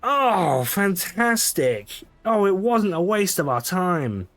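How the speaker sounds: high, drawn-out delight; delighted relief. Acted. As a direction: exclaim it high and excitedly. Delighted the visit wasn't wasted.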